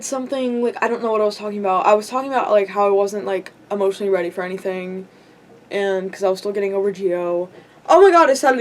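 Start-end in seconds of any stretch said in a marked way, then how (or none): none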